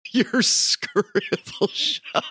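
slow, high, drawn-out teasing repeat; mocking echo. Co-host teasing the name for the workroom, mocking echo.

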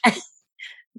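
real amused laughter breaking into the words; laughing at oneself. Real, not acted. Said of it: "I'm laughing at myself".

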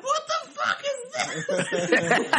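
very high, slow, laughing disbelief; baffled. Retold or imitated. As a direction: squeal it in confused disbelief. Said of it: "he's like".

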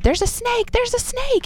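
high-pitched mock panic yell; retold scream. Mom shrieking to her daughter about the snake, high mock panic. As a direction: squeal it like a startled mom. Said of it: "I screamed after my daughter".

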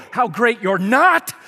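loud, slow, sarcastic outburst; mock road rage. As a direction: blow up at the driver who cut you off. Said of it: "you just kinda blow up in that moment. You call it road rage".

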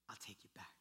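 low, hushed whisper; whispered reassurance. Acted. God to one who turns back, whispered. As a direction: whisper it as a promise. God's welcome, quoted softly.

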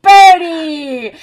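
screaming the name high and very loud; a crowd cheering wildly at the fire; jubilant crowd shout. Acted. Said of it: "the people around the fire went".

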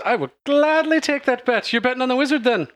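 high, quick showy female voice; Kalora speaking. Acted. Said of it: "she smiles and she says".

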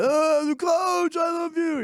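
put-on high, long-drawn drunken holler; drunk gushing fan. Drunk fan gushing to the coach outside a bar, imitated. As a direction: stretch it out high, imitating a sloppy adoring drunk. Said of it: "I was probably all drunk".

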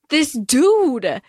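high, incredulous mock outrage; exasperated disbelief. Acting out Dree as Liam walks out on her, exasperated. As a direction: play her fed up, ending in a groan.

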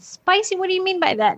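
high puzzled question. Retold or imitated. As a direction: ask it high and baffled. Mother puzzled by son.

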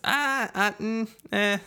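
put-on high-pitched London-accent noises; mock London accent. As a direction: babble wordlessly in a hammed-up London accent. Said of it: "That's not this guy's accent".

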